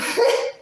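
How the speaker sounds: shrieking high laugh; high squeal of laughter. Real, not acted. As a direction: lose it completely, high and loud. Laughing at the pig story.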